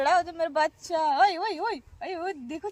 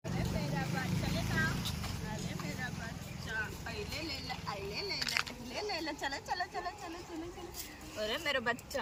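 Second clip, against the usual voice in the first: soft cooing; 12 dB quieter than usual. Real, not acted. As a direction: hush and coo almost under the breath. Cooing baby very softly. (बहुत धीमी आवाज़ में बच्चे को पुचकारना)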